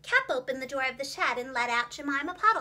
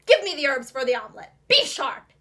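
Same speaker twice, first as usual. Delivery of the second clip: much louder, fast, snapping order; the villain's mask slips, impatient; harsh command; 10 dB louder than usual. A narrator's reading. Fox demands the herbs from Jemima, harsh and loud. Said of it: "He spoke very harshly".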